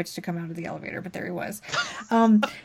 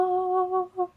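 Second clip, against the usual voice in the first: surprised oh; 10 semitones higher, 6 dB quieter than usual. Retold or imitated. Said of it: "it's like".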